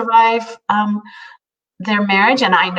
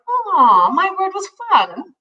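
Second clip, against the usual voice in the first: touched aw; 12 semitones higher, slower than usual. Retold or imitated. Touched reply to husband.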